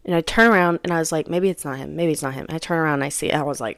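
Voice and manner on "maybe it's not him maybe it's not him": low, soft talking to herself; muttered self-reassurance. Retold or imitated. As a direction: retell it under your breath. Retells hopeful muttering to herself.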